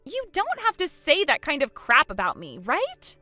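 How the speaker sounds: loud, high-pitched objection; acted panicked indignation; desperate protest. Protests insults while begging.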